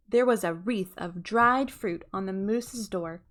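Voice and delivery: ordinary delivery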